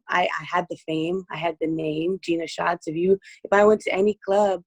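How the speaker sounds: usual speaking voice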